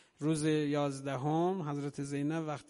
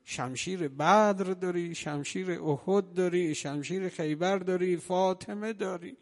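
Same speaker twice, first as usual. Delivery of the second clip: louder, higher chanted list; preaching litany; 4 semitones higher, 5 dB louder than usual. Acted. Preacher lists Ali's swords to the mourners, rising litany. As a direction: build each line like a rallying cry.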